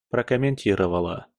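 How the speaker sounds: ordinary delivery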